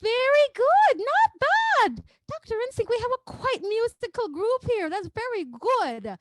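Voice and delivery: very high, hooty character voice; loud high exclamation easing into drawn-out praise; put-on praising voice. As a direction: praise them in a silly high-pitched voice. Mock-grand praise of the class.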